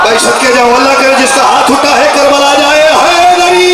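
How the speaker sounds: loud, rising declamatory cry to the crowd; full-force devotional oratory; impassioned majlis recitation. Acted. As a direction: build the verse into a rousing shout.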